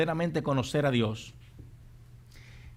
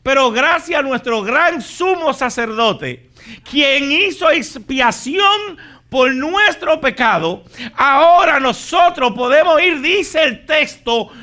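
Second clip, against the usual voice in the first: loud, high, impassioned sermon delivery; fiery preaching; 11 semitones higher, 14 dB louder than usual. Acted. Pastor urging the congregation toward God's throne, full force. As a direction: preach it to the rafters.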